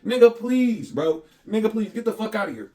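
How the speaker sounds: high, dismissive cussing off; scornful retold brush-off, loud at the start; re-enacted rant.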